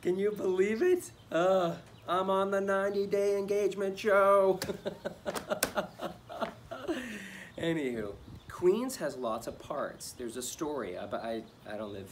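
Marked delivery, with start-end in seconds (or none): 0.0-4.6 high, loud, thrilled gushing; giddy disbelief. Acted. Man bragging he made the engagement show, giddy. Can't believe he's on the show. 4.7-7.5 long, high giggling fit; giddy laugh. Acted. Man thrilled to be on the show, giddy giggling.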